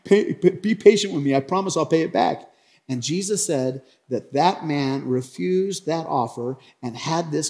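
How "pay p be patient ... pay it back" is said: hurried, stammered mock plea; begging. Debtor begs the servant for patience, acted pleading. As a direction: plead a little higher, tripping over the words. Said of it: "fell to his knees and begged him".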